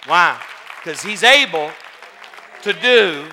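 loud, drawn-out pulpit climax; slow, shouted sermon declaration; full-force preaching. Real, not acted. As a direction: drive the promise home to the congregation.

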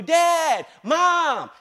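put-on high, drawn-out kid's holler; calling out for parents. Hurt child yells for a parent, calling out. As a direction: holler it like a child needing rescue.

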